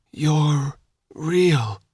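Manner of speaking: weak dry croak; barely-there rasp. Acted. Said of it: "he croaked".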